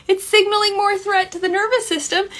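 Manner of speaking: squeaky-high, hurried aside; high-pitched. Real, not acted. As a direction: race through it way up in pitch. High aside after a laugh.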